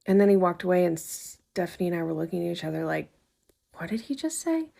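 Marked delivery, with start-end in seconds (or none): 3.8-4.7 a high, hushed "what just happened" question; half-whispered double take at the rude waiter; stunned disbelief. Retold or imitated. Stunned look between two friends.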